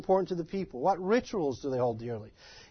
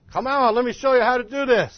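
loud, higher-pitched hands-on insistence; raised, insistent voice; urging townsfolk; 6 semitones higher, 7 dB louder than usual. Retold or imitated. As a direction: call him over to join the job. Acting out townsfolk urging him on.

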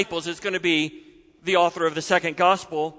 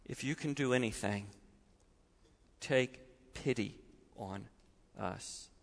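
much softer, near-broken pleading; desperate plea; 9 semitones lower, 17 dB quieter than usual. Acted. Tearful father begging Jesus for pity, very soft. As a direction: beg almost without voice. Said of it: "desperation in the voice of the Father".

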